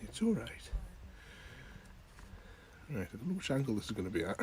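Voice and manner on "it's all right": high soothing. Real, not acted. Soothing pet.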